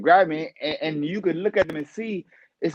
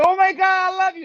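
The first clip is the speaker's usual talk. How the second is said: much higher, squealing fan; gushing fan; 5 dB louder than usual. Retold or imitated. Stranger hugging the boxer, gushing much higher. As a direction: gush like a starstruck fan.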